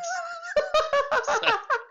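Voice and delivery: a shrill, helpless laugh bursting out; high burst of laughter. Real, not acted. Laughing at himself after flying the maneuver backwards, high laugh. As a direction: laugh high and loud at your own blunder.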